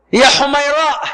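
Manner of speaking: lifted, loving call of a pet name; affectionate pet-name call. Shown as a demonstration. The Prophet calling his wife, affectionate pet-name call. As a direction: coax her with a sweet nickname. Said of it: "used to call Aisha sometimes".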